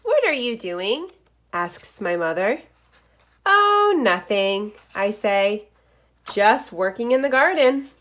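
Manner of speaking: lively picture-book read-aloud; reading to children. Acted. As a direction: read it like story time for first graders. Reads picture book aloud to class.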